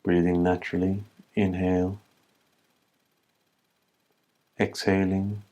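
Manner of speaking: calm, even meditation voice with long pauses; slightly softened, level-toned meditation guide; guided meditation. Acted. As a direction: guide them gently inward, leaving space between phrases. Leads a humming breath meditation.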